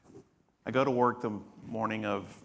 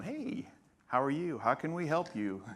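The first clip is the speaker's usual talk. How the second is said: higher, eager friendly welcome; friendly greeter; 6 semitones higher than usual. Retold or imitated. ER staff welcoming an arriving patient, high friendly greeting. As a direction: play the chipper front desk greeting a patient. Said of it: "Really friendly, really nice".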